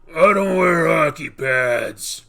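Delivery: hammed-up Batman yell; Batman impression.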